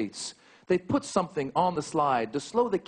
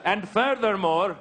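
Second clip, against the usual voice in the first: mock formal statement; 5 semitones higher than usual. Mock official terrorist statement.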